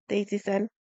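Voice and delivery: usual speaking voice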